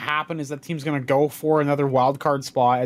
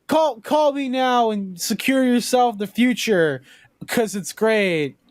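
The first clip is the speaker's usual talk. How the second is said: lawyer commercial voice; 9 semitones higher than usual. Retold or imitated. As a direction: sell it like a TV lawyer. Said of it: "the lawyer commercials were like".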